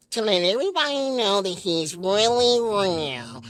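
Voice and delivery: deep, slow puppet-show host voice; Big Bird voice. Pretend. Big Bird greeting the kids on the show, deep and slow. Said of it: "Big Bird's like".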